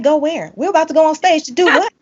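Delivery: high, incredulous questioning; retold disbelief; baffled. Retells her baffled reply to friend.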